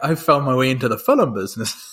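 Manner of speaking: put-on Irish accent, film as fil-um; Irish lilt, film stretched to two syllables; Colin Farrell impression. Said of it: "he's like".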